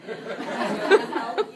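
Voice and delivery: laughing through the words; giggling loudly while speaking. Real, not acted.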